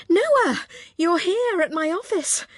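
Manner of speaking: can't believe he's here; amazed. Acted. Stunned to see her son.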